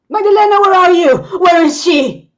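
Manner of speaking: high, loud desperate cries; frantic shout. Acted. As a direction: scream for her friend in panic. Frantic search at burning cabin.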